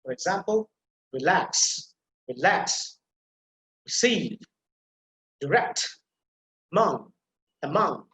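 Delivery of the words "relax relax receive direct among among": relax, receive, direct, among, each stressed on the second syllable; light first syllable, strong LAX, CEIVE, RECT, MONG. Shown as a demonstration. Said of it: "stress will be on the second syllable".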